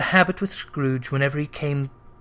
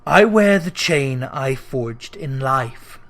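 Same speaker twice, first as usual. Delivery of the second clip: low, slow and heavy spirit; doomed ghost; 4 semitones lower, slower than usual. Acted. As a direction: speak as one bound by chains. Ghost voice held.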